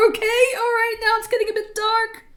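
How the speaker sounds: high, loud, amused alarm; laughing. Real, not acted. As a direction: squeal it through leftover laughter. Recovering from a laughing shriek.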